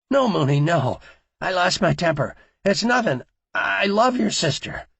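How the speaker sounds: high, loud frightened begging; pleading in terror. A narrator's reading. Terrified man pleading at gunpoint.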